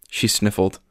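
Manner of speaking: plain reading voice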